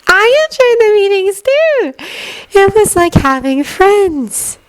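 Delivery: high, slow and wistful; wistful Luna voice. A narrator's reading. Luna remembering the meetings, slow and wistful.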